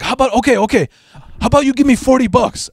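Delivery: put-on high, haggling Cheech-style Mexican accent; Mexican accent impression. Thief haggles over the stolen bike, high Mexican accent. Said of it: "that typical Cheech Mexican accent".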